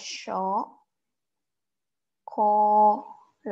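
"cho-, co-lade" drawn out as SHO, KO, LA-de; syllable-by-syllable pronunciation. Shown as a demonstration. Teacher sounds out the German chocolate, syllable by syllable. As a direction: stretch "cho-, co-lade" with long pauses between syllables. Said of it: "We pronounce it as".

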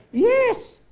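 high, loud exultant yes; preacher's triumphant cry to the congregation; excited shout. Real, not acted. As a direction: punch it out to rouse the church. Congregation rallying affirmation.

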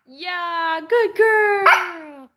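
high-pitched, delighted praise for a dog; praising pet talk. Real, not acted. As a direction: coo high with real approval.

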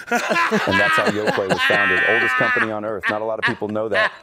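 hammed-up big-voice fake fact; mock grand announcement. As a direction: proclaim it like a pompous documentary narrator. I'm just kidding.

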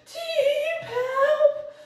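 very high, loud squeal of a little bird; desperate peeping. A narrator's reading. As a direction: peep desperately for help, shrill and loud. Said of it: "Hij piepte wanhopig". (wanhopig piepend)

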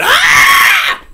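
very high, loud shriek; retold piercing scream; terrified holler. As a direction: shriek for your life. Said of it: "I hollered".

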